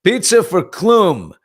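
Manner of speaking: high, loud, slow sing-song call; loud slow vendor's call. Retold or imitated. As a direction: call it out across the room like a vendor. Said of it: "and I just went".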